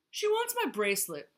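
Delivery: high, loud complaint; grumbling. A narrator's reading. Vulture complains to Yak and Zebra, grumbling. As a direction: grumble it out forcefully. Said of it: "grumbled Vulture".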